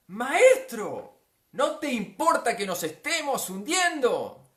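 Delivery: high, drawn-out reproachful shout; loud reproach. Acted. Disciples waking their sleeping master, shouted reproach. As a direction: call him out loudly and slowly. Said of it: "es un reproche".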